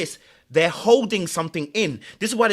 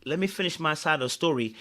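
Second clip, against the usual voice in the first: quieter, restrained mock quote; cool, composed; 3 semitones lower, 6 dB quieter than usual. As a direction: imitate someone coolly holding the evidence. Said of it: "When someone is that cool".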